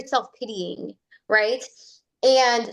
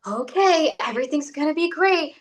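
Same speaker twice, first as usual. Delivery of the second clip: higher, bright forced cheer; forced reassurance; 6 semitones higher than usual. Retold or imitated. Imitating an anxious driver faking positive self-talk. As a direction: talk yourself into it a bit too hard. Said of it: "trying to convince yourself".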